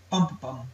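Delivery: slow, stressed first beat; DUM-da-da, lean on the first; dactyl rhythm. Shown as a demonstration. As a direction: say bump-bum slowly, first beat hit hard. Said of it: "a foot with a".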